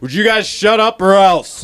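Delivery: loud, slow angry father; angry dad. Retold or imitated. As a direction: tell the kids off. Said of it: "your dad comes in".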